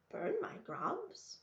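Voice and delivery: high, slow startled question. A narrator's reading. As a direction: recoil in disbelief. Old woman startled by threat.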